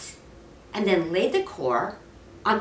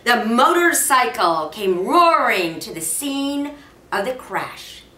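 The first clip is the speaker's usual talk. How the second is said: plain reading voice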